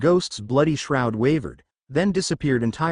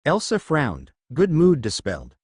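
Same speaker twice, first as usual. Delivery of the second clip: plain reading voice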